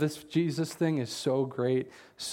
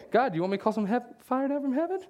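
much higher, fast, gleeful mock zeal; hammed-up eager revenge; 10 semitones higher than usual. Pastor asking God for fire, hammed-up glee. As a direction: ham up the eager smiting. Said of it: "That's what I'd be saying".

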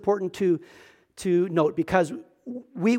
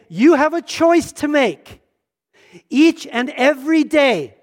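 slow, higher, weighted phrases; emphatic challenge; 4 semitones higher, 4 dB louder, slower than usual. Acted. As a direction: stretch it out to press the choice home. Voices Jesus' emphatic challenge.

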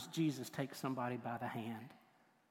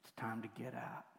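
soft, hushed gentle waking; tender wake-up; 3 semitones lower, 10 dB quieter than usual. Acted. Jesus waking the little girl, tenderly hushed. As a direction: coax a sleeping child awake, very softly. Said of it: "He just leaned over and said".

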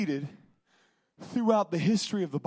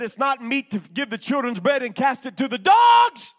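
shouted Jesus quote; 6 semitones higher, 10 dB louder than usual. Retold or imitated. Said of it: "Then he said".

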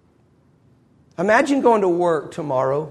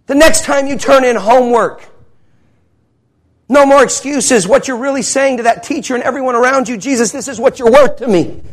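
loud, high, driving sermon voice; fiery preacher; preaching at full force; 8 semitones higher, 9 dB louder than usual. Acted. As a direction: hammer it home from the pulpit.

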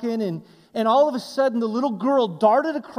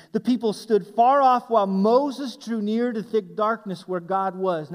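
ordinary talk

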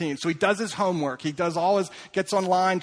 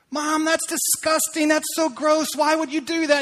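put-on high, loud outraged kid; disgusted boy; 9 semitones higher, 4 dB louder than usual. Boy recoiling at his mom's brownies, high and disgusted. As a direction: recoil like a grossed-out twelve-year-old.

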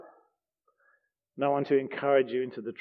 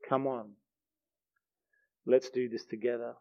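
reach out a hand softly; quiet reassuring voice from heaven; gentle invitation; 2 semitones lower, 7 dB quieter than usual. Retold or imitated. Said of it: "this hand reaches out".

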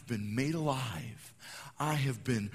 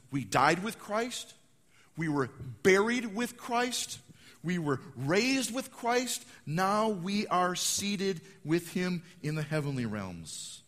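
loud, slow, mounting proclamation; fervent pulpit build; preaching build; 5 semitones higher, 5 dB louder, slower than usual. Acted. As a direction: build each line like a drumbeat. Preaching build, died buried raised.

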